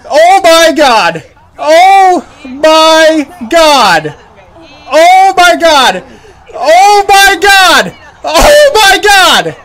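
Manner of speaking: slow, loud, high disbelief; drawn out, overwhelmed with awe; stunned shouting. Real, not acted. Disbelief after catch.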